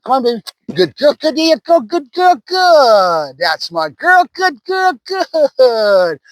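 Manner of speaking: high, bright cheering for the dog; excited puppy praise. Real, not acted. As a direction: cheer the puppy on as she grabs the toy.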